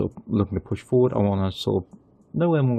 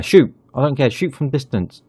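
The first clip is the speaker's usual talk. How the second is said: loud, much higher yell; shouting; 3 semitones higher, 4 dB louder than usual. Real, not acted. Manager yelling at the player to shoot, loud. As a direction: bark the order at the screen.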